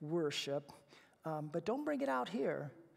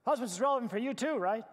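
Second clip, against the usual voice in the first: teasing jab; 5 semitones higher, 7 dB louder, faster than usual. Real, not acted. Teasing the husbands.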